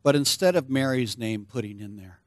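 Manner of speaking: ordinary delivery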